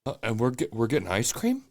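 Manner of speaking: lightly voiced befuddled president; Joe Biden. Co-host mocking the president off topic, halting impression. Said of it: "My favorite thing with him".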